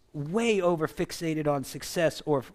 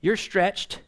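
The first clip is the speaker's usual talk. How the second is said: voicing the Lord; 6 dB louder than usual. Pastor voices God warning the church, raised and firm. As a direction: speak as God cautioning his people. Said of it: "the Lord is saying".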